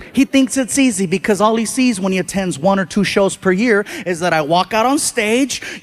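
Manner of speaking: ordinary talk, nothing marked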